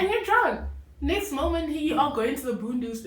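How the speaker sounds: high, loud, exclaiming it; excited outburst. Real, not acted. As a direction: blurt it out in disbelief. Echoing friend, building outrage.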